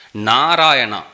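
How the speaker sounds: NARAYANA loud with a long first Na; drawn-out syllables. Shown as a demonstration. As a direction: stretch out "Narayana" loudly, opening syllable held. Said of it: "is two syllable".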